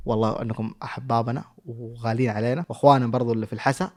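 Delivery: ordinary talk, nothing marked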